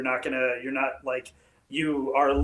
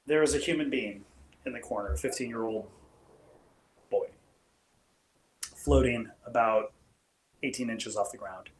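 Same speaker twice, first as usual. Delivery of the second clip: ominous scene setting; 2 semitones lower than usual. Acted. Game master sets the scene.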